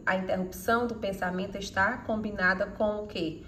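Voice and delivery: ordinary talk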